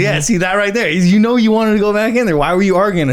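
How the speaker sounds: high-pitched, pressing retold argument; insistent buddy. Buddy arguing you wanted back in, high and insistent. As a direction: imitate a friend badgering, pitched well up.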